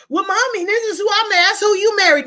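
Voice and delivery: mock child. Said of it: "my child will be like".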